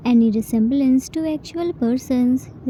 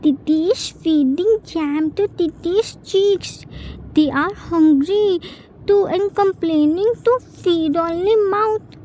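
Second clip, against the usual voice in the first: higher, lightly voiced little child, sweetly innocent; the baby innocently excusing the jam on his cheeks; innocent toddler; 5 semitones higher than usual. A narrator's reading.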